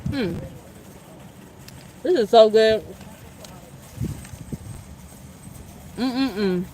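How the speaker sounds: talking while chewing; words round a bite of sandwich; mouth full. Real, not acted. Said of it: "I'm just eating my sandwich".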